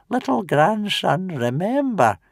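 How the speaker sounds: put-on high, wheezy old man; grandfather's parting words to Hiccup; wheezing old grandfather. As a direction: wheeze it out lovingly. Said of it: "wheezed old Wrinkly".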